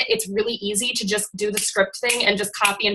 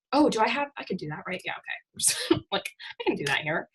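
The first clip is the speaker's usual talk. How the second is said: quiet aside; 8 dB quieter than usual. Real, not acted. Muttering aside while sharing screen.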